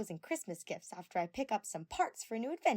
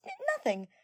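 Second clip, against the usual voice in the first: very high flustered stammer; 7 dB louder, faster than usual. A narrator's reading. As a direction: blurt it out caught off guard. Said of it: "Tenko stammered".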